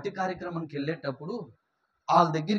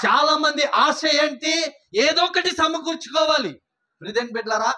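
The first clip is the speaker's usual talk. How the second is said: loud, high shouted sermon; fiery preaching; 9 semitones higher, 8 dB louder than usual. Acted. Pastor to congregation, fiery shouted preaching. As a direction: keep preaching at full force.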